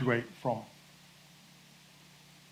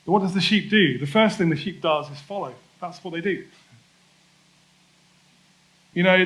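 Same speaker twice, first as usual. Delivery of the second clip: louder, higher preaching push, driving the point; preaching with force; 4 semitones higher, 4 dB louder than usual. Acted. Pastor urging the congregation to follow, forceful preaching. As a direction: build the sermon up to its core, pressing every line.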